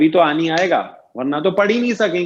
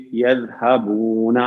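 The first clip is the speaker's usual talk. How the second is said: slow model word; 7 semitones lower, much slower than usual. Shown as a demonstration. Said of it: "teacher models the plural form".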